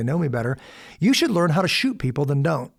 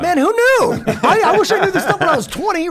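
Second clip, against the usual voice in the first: real laughter, pitched much higher and louder; laughing; 8 dB louder than usual. Real, not acted. Laughing at his younger self with the host, real laughter. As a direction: laugh through it, amused at your younger self.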